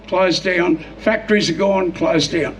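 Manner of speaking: ordinary delivery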